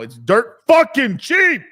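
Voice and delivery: slow, high, punched-out yell; excited hype shout. Real, not acted. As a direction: shout every word like a bargain. Excited hype about cheap coin.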